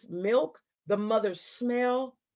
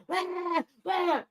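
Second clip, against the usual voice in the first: mimicked baby wail; 7 semitones higher than usual. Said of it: "all I hear".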